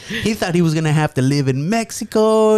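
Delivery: high, slow, drawn-out teasing; mocking uncle. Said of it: "takes the opportunity to chime in".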